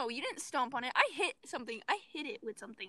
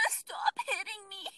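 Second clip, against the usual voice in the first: mock whine; 6 semitones higher, slower than usual. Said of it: "you said".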